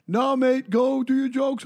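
high-pitched heckler's plea; imitated audience member. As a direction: mimic someone calling from the crowd.

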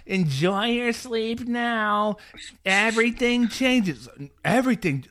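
high, slow ominous imitation; mock warning parent. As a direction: drag out the smug warning.

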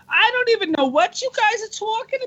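high-pitched put-on female voice; hot female voice. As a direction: play a woman, pitched well up. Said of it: "Let's hear that hot female voice".

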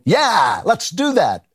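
playfully eager goading; mock eager agreement. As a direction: act keen to join the plot. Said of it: "did they say, you know".

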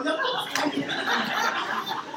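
high, slow laughing tease; laughing joke. Retold or imitated. A disciple laughingly teasing Jesus about everyone touching him. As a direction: laugh through the line.

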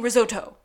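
risotto with a crisp, hard T; "risotto" punched out with a hard T; hard-T pronunciation. Retold or imitated. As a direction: mimic them saying "risotto" with a hard T. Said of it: "with a very hard T".

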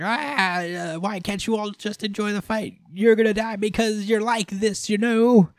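high, playful villainess taunting her victim; Vanica voice. Retold or imitated. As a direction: taunt them like a giddy sadistic girl. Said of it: "And Vanica's like".

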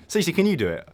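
usual speaking voice

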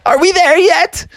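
high, loud blurted question; impatient outburst. Acted. Froggy gives in and asks dad, loud impatient outburst. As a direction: burst out, unable to hold it in.

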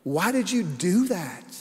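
mock tearful child's voice, slow; lightly voiced hurt little girl; imitating crying daughter. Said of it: "She says".

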